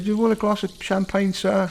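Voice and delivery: high, mock-polite dealer offering champagne; fawning salesman. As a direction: overdo the politeness like a smarmy dealer. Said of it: "so he said".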